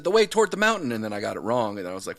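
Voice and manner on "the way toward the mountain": put-on high, loud, eager child's answer; eager kid answer. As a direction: answer like a keen little kid, pitched high. Child self in school story.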